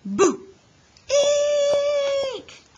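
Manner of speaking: very high, loud squeal; frightened shriek. Acted. Froggy shrieking when Frogolina chases him for a kiss. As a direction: shriek in panic. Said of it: "cried Froggy".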